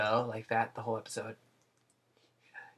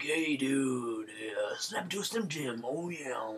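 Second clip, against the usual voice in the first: Randy Savage voice; 4 dB quieter, slower than usual. Retold or imitated. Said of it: "he sounds like a chain smoker".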